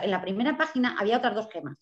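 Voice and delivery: ordinary talk, nothing marked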